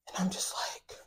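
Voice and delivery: breathless whisper. Real, not acted. Stunned at being called out.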